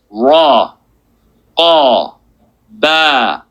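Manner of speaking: each letter drawn out slowly; slow letter reading. Shown as a demonstration. Teacher sounding out a word slowly for students. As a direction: spell it out syllable by syllable. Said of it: "put a whole word together".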